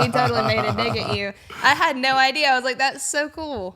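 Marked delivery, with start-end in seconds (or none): 1.6-3.8 high and loud, thrilled; bubbly surprise; excited. Real, not acted. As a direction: gush with delight at the news. Excited realization about dig.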